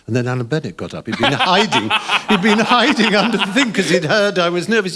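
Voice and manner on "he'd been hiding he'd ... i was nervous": laughing. Real, not acted. Laughing while recalling Alan Bennett.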